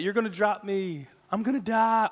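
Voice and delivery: milder mock-panicked kid; imitated freaking out. Stuck boy telling dad he'll fall, mild mock panic. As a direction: wail it a little, recalling a child's fright. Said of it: "I'm freaking out".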